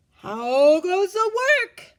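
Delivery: slow, high, loud drawn-out call; calling farmer. A narrator's reading. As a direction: stretch the call out lazily from bed. Farmer calls again.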